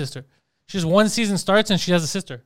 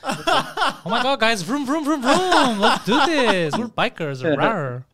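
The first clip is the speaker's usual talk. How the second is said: drawn-out, singsong flamboyant excitement; theatrical camp voice; 3 semitones higher, slower than usual. Acted. Theater guy riding with the gang, theatrical. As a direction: ham it up as a gushing actor playing biker. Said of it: "That's a theater voice".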